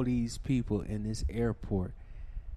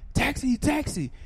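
taxi tout call; 4 dB louder than usual. Retold or imitated. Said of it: "people grabbing my bags".

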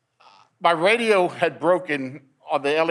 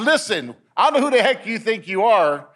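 higher, irritated challenge; retold mad; 6 semitones higher, 3 dB louder than usual. Injured officer challenging the unknown voice, mad. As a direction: re-enact losing patience with someone unseen. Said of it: "now I'm getting mad".

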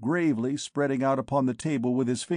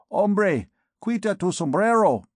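higher, louder rough Spanish order; knave barking in Spanish; 7 semitones higher, 4 dB louder than usual. A narrator's reading. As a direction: bark it at the prisoner in Spanish. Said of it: "said one of the three knaves".

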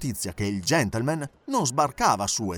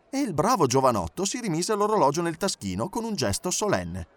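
usual speaking voice

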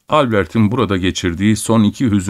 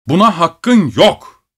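shouting; 8 semitones higher, 3 dB louder, slower than usual. A narrator's reading. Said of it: "diye bağırıyordu".

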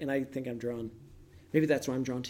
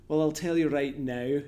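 playful Scottish accent, stretching the words; 6 dB louder than usual. Shown as a demonstration. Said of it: "I give them the Scottish accent".

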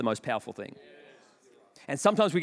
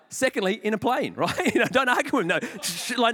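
normal talking voice

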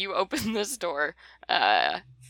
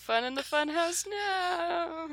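mock teasing cry; 12 semitones higher, slower than usual. Teasing after her injury.

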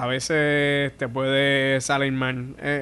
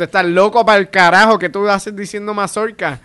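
much louder, incredulous jab; loud mock callout; 6 semitones higher, 8 dB louder than usual. Comic loudly scolds the heckler yelling mazorca. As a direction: shout it at him in disbelief. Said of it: "señalando precisamente lo que estaba".

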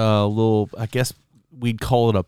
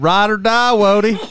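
put-on Southern accent; 11 semitones higher, 5 dB louder than usual. Uncle greeting the celebrity, in a mock Southern accent. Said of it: "You're not far off from his accent".

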